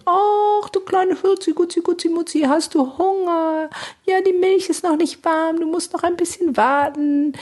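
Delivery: put-on high, sing-song mother cooing to a baby; baby talk. Mother soothing her crying baby, high cooing baby talk. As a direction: coo at the hungry baby like a doting mum. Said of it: "so sprechen wir mit Säuglingen".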